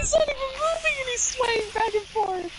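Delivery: high-pitched, helpless amusement; crying with laughter. Real, not acted. Player pointing out a swaying glitched character, crying with laughter. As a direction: pitch shoots up as if about to lose it. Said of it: "I'm crying".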